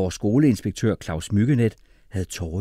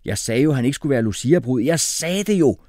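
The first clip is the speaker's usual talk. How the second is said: raised, indignant I-told-you-so; shouting; 5 semitones higher, 4 dB louder than usual. A narrator's reading. Jeppe protesting about the Lucia bride, shouting. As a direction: call it out, rising higher at the end. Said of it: "råbte Jeppe".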